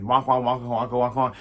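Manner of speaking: imitated arcade munching noise; Pac-Man. Storyteller compares the gnawing to a game, imitated munching. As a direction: repetitive wonk-wonk chomping. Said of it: "like, Pac-Man".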